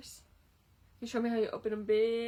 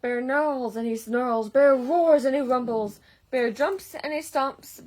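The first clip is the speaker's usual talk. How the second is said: louder for the angry bear; rising loud narration; 2 semitones higher, 10 dB louder than usual. A narrator's reading. Reading the angry bear to children, loud and building. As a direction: build the bear's rage as you read it.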